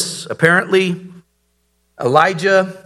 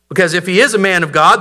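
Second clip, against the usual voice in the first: ordinary talk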